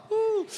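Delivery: a quick high whoop after preaching; praise whoop. Real, not acted.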